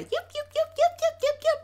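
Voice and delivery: high, rapid little yips; high-pitched mock yapping. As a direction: yap like a small noisy dog. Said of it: "Just doing all that".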